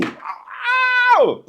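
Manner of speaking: very high, drawn-out whoop; excited squeal. Retold or imitated. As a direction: squeal with delight like a kid. Excited cheer at helicopter launch.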